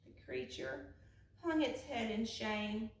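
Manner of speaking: ordinary delivery, nothing marked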